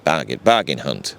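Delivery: David Dickinson catchphrase. Retold or imitated. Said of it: "He sounds like David Dickinson".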